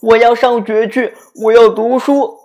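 high loud announcement; loud declaration. A narrator's reading. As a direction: proclaim it boldly. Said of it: "他向爸爸妈妈宣布".

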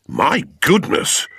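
ordinary talk

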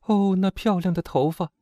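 put-on high-pitched girlish lament; regretful sigh of admiration in a high voice. A narrator's reading. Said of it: "亚米莱小姐遗憾地说".

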